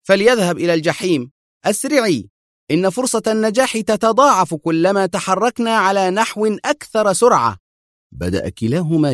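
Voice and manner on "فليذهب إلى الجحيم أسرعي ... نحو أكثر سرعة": high, firm urgent command; stern shout. A narrator's reading. Adham urging Mona to move faster, stern. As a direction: snap at her to hurry, sternly. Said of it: "صاح بها أدهم في صرامة".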